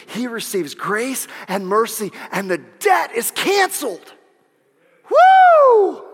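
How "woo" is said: high, loud woo of joy; joyful whoop. Real, not acted. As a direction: shout it out in celebration. Celebrating canceled debt.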